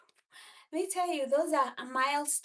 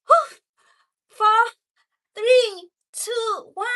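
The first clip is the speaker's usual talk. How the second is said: high, loud strained count; straining countdown; 7 semitones higher, 11 dB louder than usual. Real, not acted. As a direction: gasp out the last seconds. Counting down final plank seconds.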